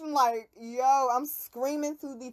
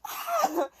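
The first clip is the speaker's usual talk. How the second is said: mock scream; 8 semitones higher than usual. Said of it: "screaming at the top of my lungs".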